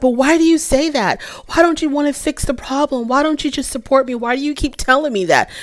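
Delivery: wife voiced high and pleading; imitating wife. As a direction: whine it like the hurt wife. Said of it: "she was like".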